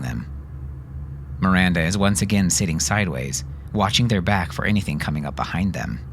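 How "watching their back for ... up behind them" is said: ordinary delivery, nothing marked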